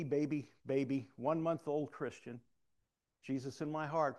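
ordinary talk, nothing marked

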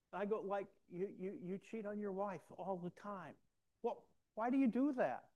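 lightly voiced earnest challenge to the cop; lost person. Retelling how he challenged the backslidden officer, mildly mimicked. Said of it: "this is a lost person speaking".

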